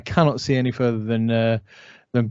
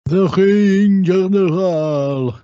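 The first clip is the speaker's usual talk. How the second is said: grand, drawn-out ring announcer proclamation, higher and louder; Ludwig Kaiser impression; 7 semitones higher, 5 dB louder, much slower than usual. Podcast host mimicking commentary on Ludwig Kaiser, grandly drawn-out announcer voice. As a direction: stretch every word out into a booming introduction. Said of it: "all of his commentary of, uh, Ludwig Kaiser".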